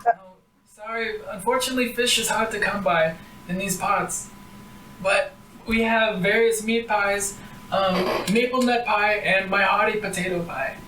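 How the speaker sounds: lightly voiced old granny, apologetic; a hint of an old village woman. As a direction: apologize gently like a kindly grandma.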